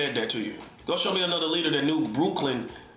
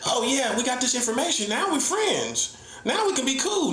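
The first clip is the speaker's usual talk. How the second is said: high, sing-song mock of the critics; 9 semitones higher than usual. Mocking critics who want to be cool, in a high sing-song voice. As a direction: parody them as naive and eager to be friends.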